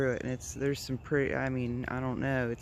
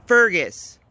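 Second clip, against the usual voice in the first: loud sharp call, higher; shout to the dog; 6 semitones higher, 11 dB louder than usual. Real, not acted. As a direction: yell the dog's name to stop him. Calling the dog off the rabbit.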